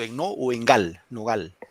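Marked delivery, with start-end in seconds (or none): 1.1-1.6 "Nogal" said with gal stressed; teacher modelling stress on gal in "Nogal". Shown as a demonstration. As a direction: lean on the last syllable of "Nogal". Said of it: "¿Dónde recae la mayor fuerza de voz?".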